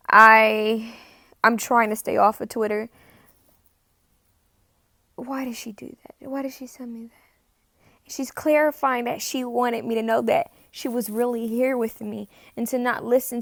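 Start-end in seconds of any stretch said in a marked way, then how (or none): 5.2-8.1 whispered, slow and soft; hushed wondering. Real, not acted. Psychic puzzling over the spirit's message, whispering. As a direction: whisper the question to yourself.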